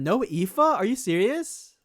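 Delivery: usual speaking voice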